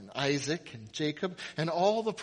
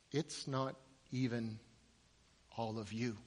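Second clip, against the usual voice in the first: quiet, grave answer; 6 semitones lower, 12 dB quieter than usual. Acted. Jesus answering a follower who asked, quiet and grave. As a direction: let it drop low, word by word.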